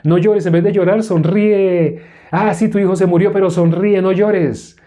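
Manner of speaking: high, singsong false comfort; hammed-up empty encouragement; mock cheery consoling. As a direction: parody someone urging a mourner to smile. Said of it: "muestre una sonrisa".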